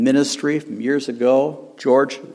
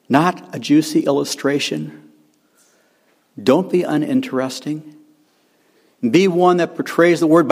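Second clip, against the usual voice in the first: normal talking voice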